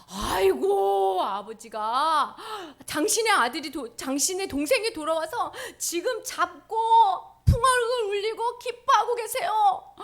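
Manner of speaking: loud, slow exclaiming servant; excited servant. Acted. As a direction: burst out with the news. Servant explains the feast.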